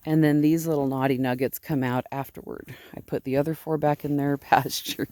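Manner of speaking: ordinary talk, nothing marked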